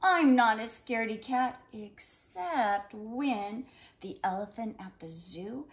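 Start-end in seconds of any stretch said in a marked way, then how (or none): none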